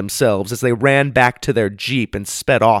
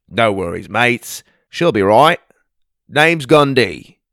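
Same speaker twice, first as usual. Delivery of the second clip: put-on Australian accent; 6 dB louder than usual. Aussie bushman greeting the kids in the pond, hearty Australian accent. Gundy speaks.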